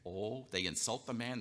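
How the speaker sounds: ordinary talk, nothing marked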